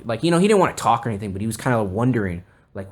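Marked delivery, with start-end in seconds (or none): none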